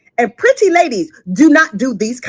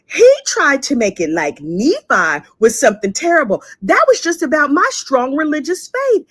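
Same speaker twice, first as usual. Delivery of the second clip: ordinary talk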